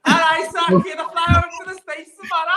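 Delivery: laughing parody of a bad American accent; mock American accent. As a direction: tease the accent attempt by hamming it up. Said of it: "She's from Liverpool".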